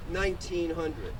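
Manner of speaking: usual speaking voice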